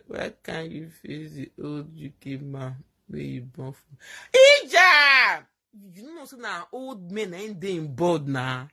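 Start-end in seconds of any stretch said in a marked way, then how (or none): 4.3-5.4 loud call. Real, not acted. Calls out loudly.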